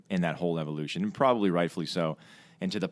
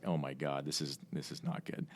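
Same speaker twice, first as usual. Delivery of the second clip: softer, low-key "oh my God" to himself; muttered dread; 3 semitones lower, 9 dB quieter than usual. Retold or imitated. Alone over the carrier, pilot mutters to himself softly. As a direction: mutter it under your breath as it sinks in. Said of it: "and I was like".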